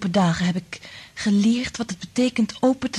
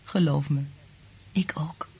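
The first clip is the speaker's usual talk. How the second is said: soft unvoiced whisper; a whispered love reply; 3 semitones lower, 4 dB quieter than usual. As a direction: breathe the words close to him. Lovers' confession, whispered.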